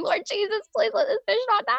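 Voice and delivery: begging Jesus, high and fast, to save the fish; retold desperate prayer. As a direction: rush a frantic little plea to God. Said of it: "And I'm just praying".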